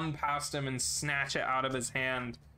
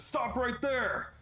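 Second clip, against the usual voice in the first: high, slow order to halt; guard shouting; 10 semitones higher, slower than usual. Acted. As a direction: bark stop at the intruder. Guard draws gun and shouts.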